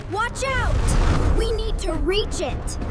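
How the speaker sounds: loud urgent yell; warning shout. Acted. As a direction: shout the warning across the room.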